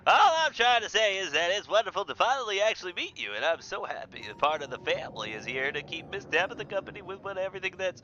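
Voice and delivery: gushing, high Southern lady; loud Southern burst of delight turning apologetic; Southern woman. Acted. Janie gushing to Henry then apologising to Tabitha, Southern accent. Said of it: "Southern woman".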